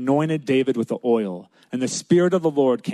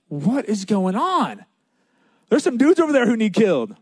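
put-on incredulous young challenger; indignant disbelief; 6 semitones higher than usual. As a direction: act out baffled outrage, pitched up. David's outrage acted out.

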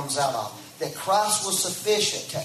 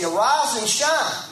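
higher, louder "Arise and shine", slow; slow higher preacher's proclamation; 7 semitones higher, 5 dB louder, slower than usual. Acted. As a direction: proclaim it with rising force. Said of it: "Isaiah sixty".

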